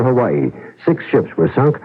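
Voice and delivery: ordinary delivery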